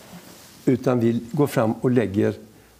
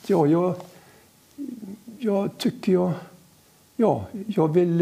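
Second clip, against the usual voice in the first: halting, higher-pitched, long pauses; hesitant; 6 semitones higher, slower than usual. Retold or imitated. Girl trying to explain her faith to the visitors, hesitant. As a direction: grope for the words, stalling between phrases.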